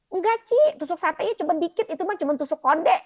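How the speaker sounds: high, loud, fast dismissive reassurance; brushing it off. Retold or imitated. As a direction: laugh off the worry quickly. Agent brushes off her worry.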